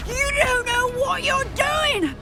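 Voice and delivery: high, slow outraged cry; desperate protest. Acted. Cassandra protesting her arrest to the officer, a desperate cry. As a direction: protest as the spell binds you.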